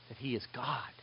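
hushed emphatic whisper on the key truth. Acted. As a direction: breathe it like a secret.

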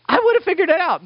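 high, loud mock boast; hammed-up know-it-all; smug hindsight. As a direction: brag you'd have known all along.

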